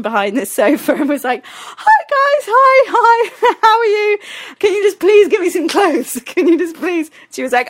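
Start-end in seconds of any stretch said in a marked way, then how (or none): 1.5-7.1 very high, squeaky chirping; mortified greeting. Retold or imitated. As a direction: cover embarrassment with over-friendly, high pleading. Naked, popping up to greet party.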